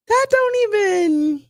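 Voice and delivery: high, slow disbelieving squeak; incredulous. Retold or imitated. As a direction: act stunned by the nonsense. Mocking her story, disbelief.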